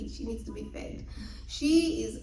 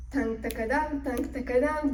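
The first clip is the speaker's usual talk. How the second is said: plain reading voice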